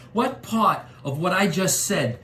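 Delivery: menacing rising tough guy rant; Joe Pesci impression. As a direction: Joe Pesci gangster voice, getting angry. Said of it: "Joe Pesci".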